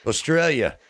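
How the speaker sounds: silly, high-pitched stab at Australian; attempted Australian accent. Shown as a demonstration. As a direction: try an Australian accent, badly. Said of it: "I always try, and it ends up sounding stupid".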